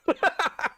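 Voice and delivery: short high laugh of disbelief; a burst of frustrated laughter; laughing at own anger; exasperated laugh. Real, not acted. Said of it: "feel myself getting more and more pissed off".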